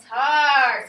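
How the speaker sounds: "Tar" punched out loud; stressed syllable. Shown as a demonstration. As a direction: hit "Tar" hard as the accented part. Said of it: "Which one do we stress".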